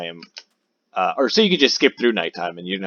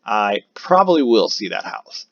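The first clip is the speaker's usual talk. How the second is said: ordinary delivery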